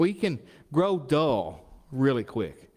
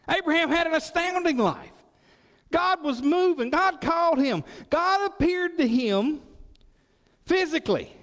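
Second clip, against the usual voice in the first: preaching at full force. Acted. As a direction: proclaim each line to the room. Preaching about Abraham.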